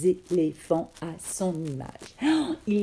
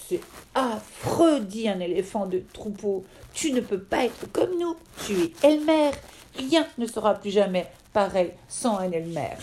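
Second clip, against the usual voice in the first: alarmed elephant insisting; dismayed protest; 3 semitones higher than usual. Acted. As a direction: protest as if something precious is lost. Elephant dismayed Elmer is gray.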